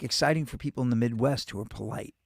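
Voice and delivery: ordinary delivery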